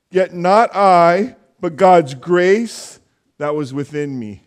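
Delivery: slow, loud, emphatic verse reading; scripture reading. Real, not acted. Pastor reading First Corinthians to the church, emphatic scripture reading. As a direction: proclaim the verse in weighty, measured phrases. Reads a Bible verse aloud.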